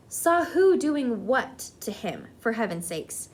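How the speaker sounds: ordinary delivery, nothing marked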